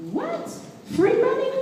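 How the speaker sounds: high squeak of disbelief at free money; surprised. Acted. Speaker reacting to the RA job offer, surprised high squeak. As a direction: jump way up in pitch, amazed.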